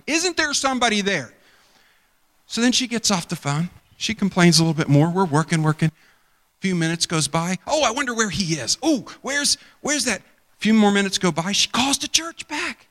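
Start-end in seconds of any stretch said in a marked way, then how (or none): 0.0-1.3 imitating a pushy, higher whine; mock her impatient demand; mimicking a demanding caller, higher and insistent. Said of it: "not nicely asking". 7.6-10.2 impatient. Retold or imitated. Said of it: "impatient woman waiting".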